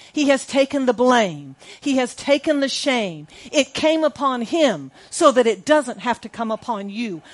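loud, high, declaring voice; God's words preached loud and high; proclaiming God's words. Acted. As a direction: announce it like a prophet. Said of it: "God says".

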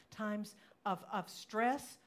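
ordinary delivery

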